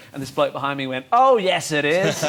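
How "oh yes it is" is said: loud, raised pantomime comeback; panto heckle. Retold or imitated. Bloke behind heckles the comedian, mock-panto call. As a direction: chant it back like a panto audience. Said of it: "This is not a panto".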